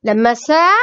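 ordinary delivery

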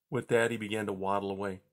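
ordinary delivery

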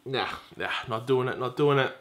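low, very soft muttering; hushed, low-pitched repeated no; muted refusal. Retold or imitated. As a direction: refuse quietly to yourself. Retold refusal after bad news.

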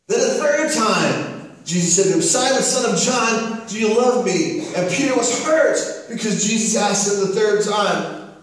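high-energy pulpit storytelling; impassioned preaching. Acted. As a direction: preach the story with rising force, landing hard on key words.

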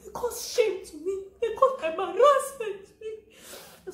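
high, slow, hammed-up crying whine; mock wailing complaint. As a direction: wail it like a wronged child, half laughing. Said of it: "I say".

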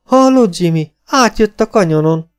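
high, loud, incredulous cry; amazed exclamation. A narrator's reading. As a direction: crow the news to your partner. Robber mocks the news, then laughs.

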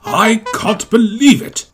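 shocked. A narrator's reading. Gordon hears the Queen has come, shocked. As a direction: weigh each word in astonishment. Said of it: "Gordon was shocked".

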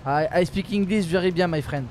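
English with a thick French accent. Shown as a demonstration. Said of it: "ton accent anglais".

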